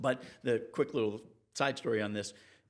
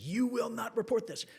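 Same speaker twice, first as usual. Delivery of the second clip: much higher voice, a barked order in a put-on voice; angry sailor; 12 semitones higher than usual. Old sailor nose to nose threatening the reporter, barked order.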